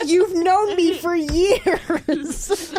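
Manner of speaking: high, slow, laughing comeback; laughing retort. Retold or imitated. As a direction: drawl it out through laughter. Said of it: "Buffy's like".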